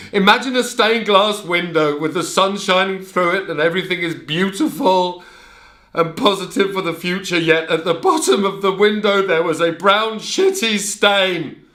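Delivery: high, loud, anguished declaration; impassioned outburst. Acted. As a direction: pour it out, loud and raw. Emotional outburst about childhood.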